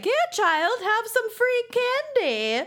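high, slow creepy crone voice; creepy old woman voice. Retold or imitated. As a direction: lure the children in like an old hag. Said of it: "she was handing out popcorn to children, like".

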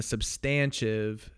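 substantive said as sub-STAN-shiv; own pronunciation. Real, not acted. Host defending his odd way of saying it, sub-STAN-shiv pronunciation. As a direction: stress the second syllable of substantive, with a sh sound. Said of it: "I pronounce it differently".

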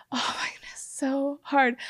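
breathy whisper in disbelief; hushed oh my goodness; whispered aside. Real, not acted. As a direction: whisper it under your breath. Aside about being yelled at.

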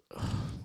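short breathy sigh of frustration; acted let-down sigh; exasperated sigh. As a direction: sigh before giving up. Bird refuses to fly.

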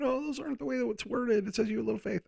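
high-pitched mock complainer; nitpicking objector. Imagined listener objecting to the Bible wording, high mock quibble. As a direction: put on a small, high voice for the quibble. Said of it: "just to eliminate anybody going".

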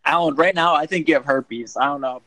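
loud, mocking call-out; loud teasing. Real, not acted. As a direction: blurt it out loud to tease. Teasing friend about herpes.

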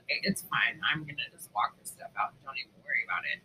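imitating Jules. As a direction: mumble it off calmly, low.

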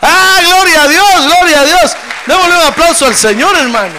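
rally the congregation in full-force praise; exultant praise shout. Real, not acted. (alabanza a gritos, eufórica)

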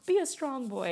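a hint of a stern mother; imitating the mom. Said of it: "she was like".

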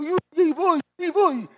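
put-on high, loud voice of a weeping boy; father voicing his crying son; crying child. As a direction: imitate a scared child pushing on through tears. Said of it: "me dijo, y iba llorando".

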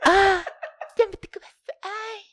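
voz de anime muy aguda y quebrada; chica de anime chillona. Pretend. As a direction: gemido agudo de chica de anime. (voz chillona de anime)

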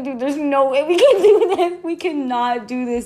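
high, frantic imitation of a friend; panicked protest. Imitating friend backing out of killing the possum, panicked. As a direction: whine it in mock panic.

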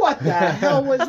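high, loud disbelief through laughter; hammed-up stunned outcry; mock bewilderment. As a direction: blurt it out as if just tossed. Retells shock at being thrown.

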